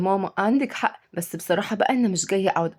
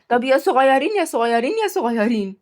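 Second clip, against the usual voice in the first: put-on higher, repetitive childish teasing; taunting; 4 semitones higher than usual. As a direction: needle them with each repeat. Taunting the boys on purpose.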